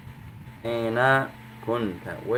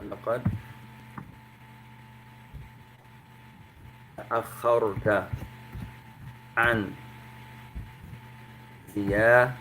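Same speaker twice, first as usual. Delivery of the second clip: slow dictation; 3 dB quieter than usual. Shown as a demonstration. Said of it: "اكتبوا هذا".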